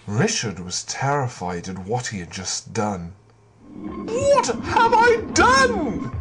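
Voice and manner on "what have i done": high and slow, aghast; horrified squeak. Acted. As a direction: gasp it out in horror. Richard horrified at the blinding.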